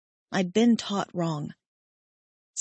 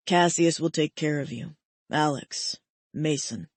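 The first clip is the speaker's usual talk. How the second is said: voice cracking; 4 semitones lower than usual. A narrator's reading. Ethan entrusting Genesis to others, voice near breaking. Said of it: "His voice cracked".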